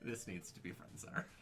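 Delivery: very soft, half-whispered imitation; hushed mock demand. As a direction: drop to a low, breathy murmur. Mock hushed demand about the picture.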